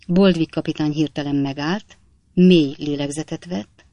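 ordinary delivery

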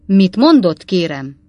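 sharp, loud angry question; a burst of barely held fury; shaking with rage. A narrator's reading. Pott to Slark, loud and seething with rage. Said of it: "tetőtől talpig remegett a dühtől".

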